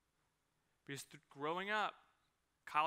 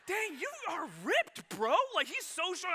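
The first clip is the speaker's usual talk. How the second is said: amazed; 11 semitones higher, 8 dB louder than usual. Retold or imitated. Dad pushing son into seat, high amazed disbelief. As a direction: marvel at how strong he is. Dad marvels at toddler's strength.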